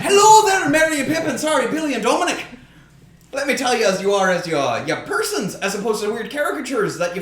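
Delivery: high, loud Midwest greeting, easing down; put-on Midwestern voicemail voice; hammed-up Midwest accent. Host acts second voicemail to podcasters, Midwest accent settling down. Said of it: "just clip that piece out".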